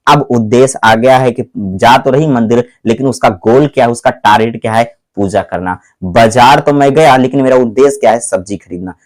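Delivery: ordinary talk, nothing marked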